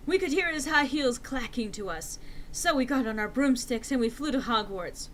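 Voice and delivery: usual speaking voice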